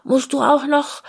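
high, pushy nagging voice; inner slave driver. Retold or imitated. Her inner taskmaster nagging about chores before New Year, high and pushy. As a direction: nag yourself like a bossy little boss. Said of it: "diese kleine Antreiberin in mir sagt".

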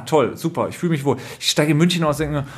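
ordinary talk

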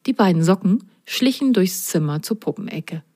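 plain reading voice

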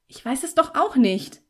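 sheepish. A narrator's reading. Girl cowed by Felix's questioning, sheepish. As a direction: admit it helplessly, intimidated. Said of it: "meinen kleinlauten Tonfall".

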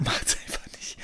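laughing whisper. Pretend. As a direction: whisper it back through a laugh.

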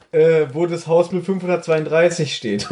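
ordinary delivery

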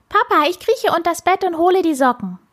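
put-on high, quick little-girl voice; eager child. A narrator's reading. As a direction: pipe up eagerly, fast and high. Child eagerly offers to help. (eifriges Kind, hoch und schnell)

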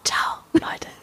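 secretive whispered goodbye; conspiratorial whisper. Pretend. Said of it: "Warum flüsterst du?".

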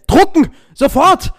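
high, loud editor's command; shouted order. Pretend. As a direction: bark the order to print. Mock editor orders printing.

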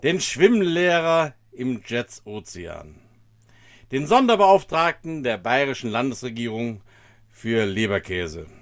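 big show announcer voice; announcer intro. Acted. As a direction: build up the guest like a gala host. Hyped show-host introduction.

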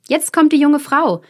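high, loud announcement with stress on kommt; calling out. Acted. Passengers in the coach announcing June, loud call. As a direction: announce her arrival to everyone. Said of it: "riefen die drinnen im Wagen".

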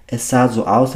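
ordinary delivery, nothing marked

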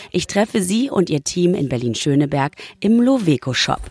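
calm reporter voiceover; voiceover narration. A narrator's reading. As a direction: read it like a TV report.

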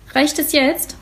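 put-on a scowling boy in the bathtub, fed up; grumpy. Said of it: "macht ein böses Gesicht".

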